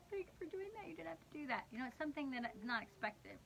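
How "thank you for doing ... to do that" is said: imitated choked-up thanks, high and soft; near-tearful gratitude. Husband thanks his wife for cleaning his desk, almost crying. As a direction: mimic someone touched almost to tears. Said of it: "almost, like, cries".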